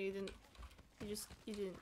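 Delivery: ordinary talk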